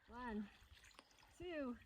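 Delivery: high yelps from the cold plunge; in ice cold water. Real, not acted. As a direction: gasp it out in freezing water. Jumping into an alpine lake.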